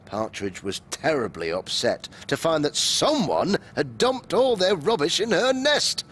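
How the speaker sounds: ordinary delivery, nothing marked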